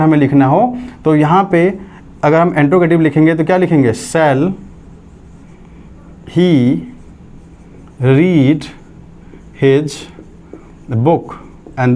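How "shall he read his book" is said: long pauses between every word; slow dictation. Shown as a demonstration. Teacher dictates question to class, slowly. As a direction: spell it out slowly for note-taking. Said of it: "dictating the question form".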